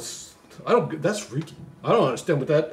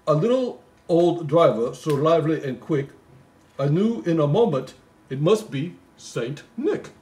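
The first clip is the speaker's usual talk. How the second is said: poem recital; 4 semitones higher, 4 dB louder than usual. Acted. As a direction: read it like a bedtime Christmas poem. Reading the poem aloud.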